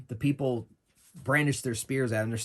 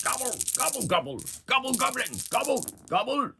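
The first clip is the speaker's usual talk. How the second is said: high-pitched gobbling creature voice; goblin chieftain; 13 semitones higher than usual. Acted. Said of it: "the chief is like".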